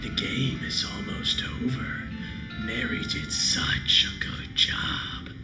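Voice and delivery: creepy whispered doll voice; eerie breathless doll whisper; talking doll. Acted. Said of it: "doll speaks".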